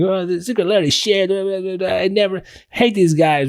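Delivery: a hint of a whining forum poster; whiny complainer. Mocking complaining posts.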